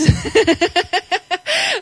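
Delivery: rising giggle at her own joke; light rising laugh. Real, not acted. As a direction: laugh it off, amused at herself.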